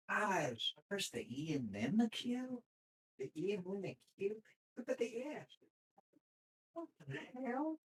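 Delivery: high, soft, incredulous mock student; baffled student voice. Mocking a confused student puzzling over E and Q. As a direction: squeak out the confusion over the keys.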